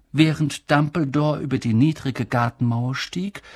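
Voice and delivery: plain reading voice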